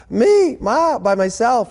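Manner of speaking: high, alarmed disbelief; a young woman caught off guard, voice jumping up; startled squeak. Acted. Ruth shocked at Naomi's plan.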